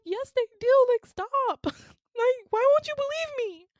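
very high, loud wail of protest; desperate plea. Retold or imitated. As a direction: beg to be believed, voice way up, acted out. Retold desperate plea to be believed.

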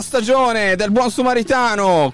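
voce alta da presentatore, carica e sostenuta; annuncio radiofonico d'apertura. Acted. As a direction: apri la trasmissione lanciando ogni nome con enfasi. Apertura da presentatore dopo la sigla. (presentatore radiofonico carico, su toni acuti)

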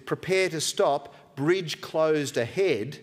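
slow, spelled-out warning sign voice; slow sign reading. Retold or imitated. Preacher reading a road sign aloud, slow and deliberate. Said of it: "a big warning sign that says".

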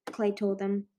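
usual speaking voice

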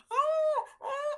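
high wailing cry, imitating herself sobbing; retold panic wail; mock crying wail. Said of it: "I'm crying".

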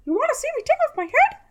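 very high, fast squeaky creepy voice; creepy mascot voice. Retold or imitated. Creepy Mickey suit guy quote.

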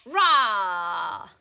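long loud monster roar; big drawn-out "rah" roar; troll roar. A narrator's reading. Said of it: "roared the troll".

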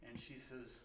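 normal talking voice

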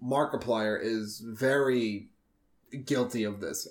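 ordinary talk, nothing marked

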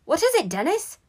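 high, loud squeaky question; almost a squeak. A narrator's reading. Brother answering the excited boy, nearly squeaking. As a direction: squeak back eagerly. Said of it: "in a voice that was almost a squeaky".

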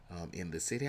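ordinary talk

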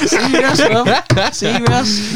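laughing loudly, high. Real, not acted. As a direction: blurt it out through laughter. Laughing at friend's wake-up call.

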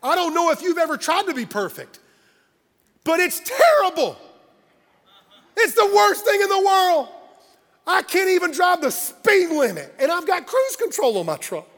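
high, loud pulpit proclamation; full-force preaching. Real, not acted. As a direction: preach it big, punching the key words.

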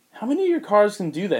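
amazed question. Retold or imitated. Tim amazed at car.

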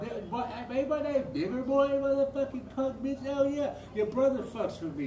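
a mock furious yell at her; intense retold rant. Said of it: "I was intense".

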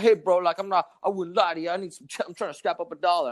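imitated beggar making his pitch; mock panhandler. Imitates second man begging for money.

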